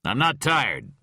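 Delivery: sharp, loud snap; irritable snap; snapped. A narrator's reading. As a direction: bite the words off. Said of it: "Rhyme snapped".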